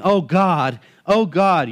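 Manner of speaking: a mock cowering worshipper begging; mock groveling. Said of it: "not groveling".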